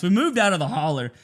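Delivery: normal talking voice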